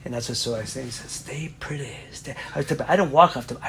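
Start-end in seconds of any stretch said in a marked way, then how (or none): 1.1-2.3 soft breathy whisper in the ear. Retold or imitated. Ali whispering to his friend in his ear. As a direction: lean in and whisper it close. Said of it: "said to me in whisper in my ear".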